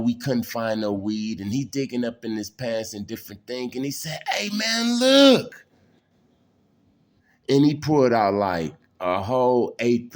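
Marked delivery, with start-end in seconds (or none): 4.3-5.6 excited call. Retold or imitated. Friend excitedly shows hidden weed.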